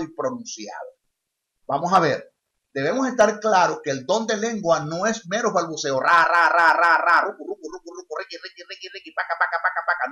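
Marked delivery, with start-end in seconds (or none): 6.0-10.1 mock babbling. Shown as a demonstration. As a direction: mimic fake tongues babbling. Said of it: "No, no, no. Eso no es lengua". (balbuceo burlón imitando lenguas falsas)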